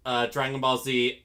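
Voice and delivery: usual speaking voice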